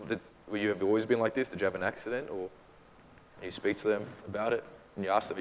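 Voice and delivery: usual speaking voice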